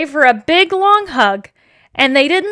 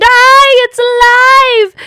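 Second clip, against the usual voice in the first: high, loud terrified shriek; screaming; 10 semitones higher, 9 dB louder, slower than usual. A narrator's reading. Lady finding the doll is alive, screaming. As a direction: scream it out in panic. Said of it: "And the lady screamed".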